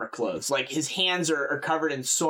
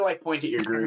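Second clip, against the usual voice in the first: ordinary talk, nothing marked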